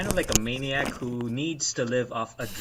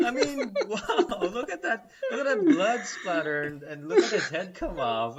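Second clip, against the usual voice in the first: high-pitched, laughing awe; acted-out delight, cracking up; gleeful marvelling; 10 semitones higher, 8 dB louder than usual. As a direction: savour the gore like a thrilled fan. Acts out delight at gory scenes.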